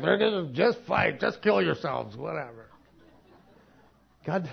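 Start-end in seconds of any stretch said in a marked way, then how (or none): 0.0-2.7 dismissive parent. Retold or imitated. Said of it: "it's like".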